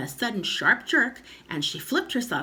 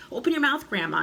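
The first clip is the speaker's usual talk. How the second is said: put-on higher-pitched boyish urging; urgent cry; 7 semitones higher, 4 dB louder, faster than usual. A narrator's reading. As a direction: call out the order. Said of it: "he cried".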